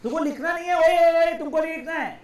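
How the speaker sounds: loud, high interjection to the class; urgent calling out. Real, not acted. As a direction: call out sharply to stop them. Teacher calls out to stop students writing.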